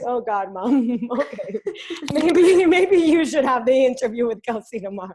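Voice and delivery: high, loud and laughing; laughing tease. Retold or imitated. Daughter laughing at her mom, suggesting she do the interview. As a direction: tease mom through giggles.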